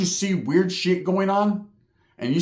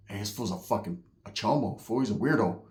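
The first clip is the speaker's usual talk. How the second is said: hushed warning; 6 semitones lower, 8 dB quieter than usual. Retold or imitated. Hushed prison talk about inmate.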